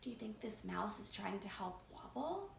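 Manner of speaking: plain reading voice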